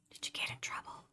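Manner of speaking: breathy repeated whisper, no voice at all. Shown as a demonstration. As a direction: repeat the question in a hushed whisper.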